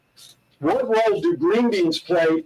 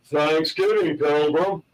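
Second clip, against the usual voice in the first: lightly voiced cowboy drawl, lower pitch; a hint of a movie cowboy, pitched down; John Wayne; 6 semitones lower, slower than usual. Host delivering his joke's punchline in a light John Wayne voice. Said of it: "say this with the accent".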